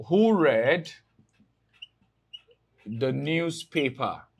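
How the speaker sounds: drawn-out words with long pauses between; slow dictation. Real, not acted. Teacher writing out option for class, slow dictation. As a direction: dictate it slowly, in separated chunks.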